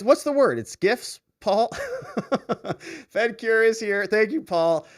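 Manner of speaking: normal talking voice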